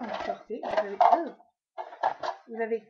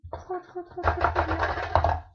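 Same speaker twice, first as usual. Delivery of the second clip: rising, slowed, louder trop trop trop bien; gushing excitement; 6 semitones higher, 6 dB louder, slower than usual. Real, not acted. Showing viewers a free mascara sample, gushing excitedly. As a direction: stretch out each trop with delight.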